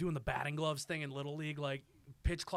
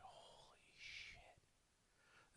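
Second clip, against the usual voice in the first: awed whisper; 25 dB quieter, much slower than usual. Retold or imitated. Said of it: "This was two thousand one. I was ten".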